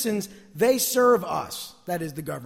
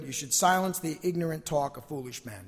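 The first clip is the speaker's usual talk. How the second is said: usual speaking voice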